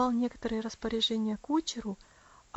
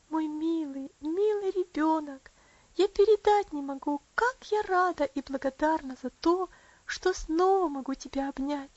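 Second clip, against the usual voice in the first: put-on higher, emotional young woman's voice; affectionate Rosa, gushing; 6 semitones higher, 4 dB louder than usual. Rosa hugging little Elsie she has missed, gushing affectionately. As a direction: pour out fondness, stretching the endearments.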